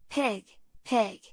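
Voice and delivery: gentle, coaxing call to a pig; kind voice. A narrator's reading. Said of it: "in a kind voice".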